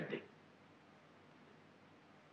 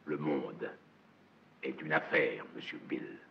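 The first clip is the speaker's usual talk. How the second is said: low, soft and grave; hushed menace; 10 semitones lower, 8 dB quieter than usual. Acted. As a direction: lean in and murmur it darkly.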